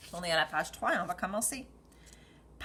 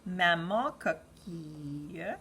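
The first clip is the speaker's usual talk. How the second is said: put-on reading to a toddler. Teacher reads the story to children.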